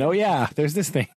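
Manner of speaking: ordinary delivery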